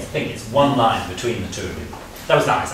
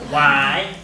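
loud, questioning why with a lift; a lightly mimicked pestering child; annoying child; 3 semitones higher, 5 dB louder than usual. Shown as a demonstration. Teacher showing musicians how to question, as an annoying child. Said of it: "You have to be that annoying child".

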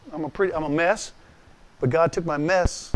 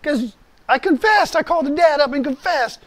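much higher, loud plaintive whine; hammed-up complaining caller; whiny complaining Marine; 11 semitones higher, 4 dB louder than usual. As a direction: whine like a sorry Marine calling the pastor. Marine voice answering pastor.

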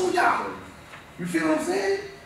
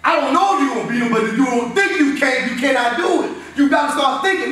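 shouted louder, fast and urgent; rising shout; 6 semitones higher, 5 dB louder than usual. Real, not acted. Coach shouts to his players so they believe they can win, fast and loud. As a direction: fire the team up with a fast shout. Firing up the team.

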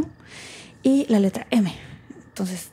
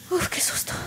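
soft, high, airy exhale of relief; breathless relief; 9 semitones higher, 6 dB quieter than usual. Acted. Alone after a scare, breathless relief. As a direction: let the fright drain out in a whisper.

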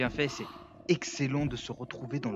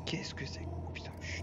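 whispering while hiding; 8 dB quieter than usual. Real, not acted. Sneaking in dark game room.

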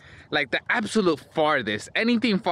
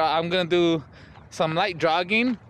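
normal talking voice

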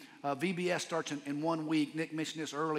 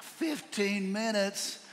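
shocked disbelief; 7 semitones higher, much slower than usual. Retold or imitated. Retold shock at short notice.

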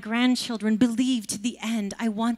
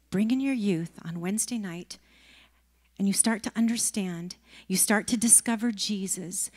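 usual speaking voice

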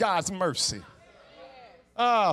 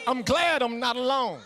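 ordinary delivery

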